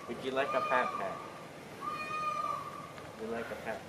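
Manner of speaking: soft coaxing to an animal. Real, not acted. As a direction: coo gently at the animal. Talking sweetly to animals.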